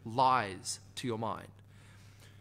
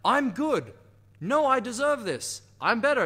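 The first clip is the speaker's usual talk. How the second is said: higher, louder mock self-assurance; defiant self-talk; 7 semitones higher, 6 dB louder than usual. Coach acting out arguing with your own doubts, loudly. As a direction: push back hard against the inner voice. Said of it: "you can't say on the other side".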